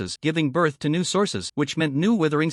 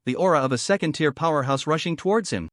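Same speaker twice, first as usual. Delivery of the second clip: usual speaking voice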